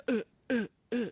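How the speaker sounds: short, loud "uh" grunts; mimicked pain grunts, evenly spaced; imitated hurt grunts. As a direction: grunt repeatedly as if standing in fire. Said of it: "we stand on it, we go".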